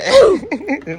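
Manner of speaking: sudden high, loud laugh at his own joke; real laugh after a fake reveal; loud burst of laughter. Real, not acted. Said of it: "मजाक कर रहा हूँ भाई".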